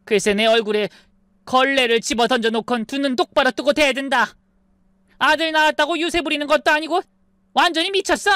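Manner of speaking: put-on shrill indignant older woman; ranting mother-in-law. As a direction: spit it out like an outraged mother-in-law. Mother-in-law ranting about her. (격분한 시어머니 목소리)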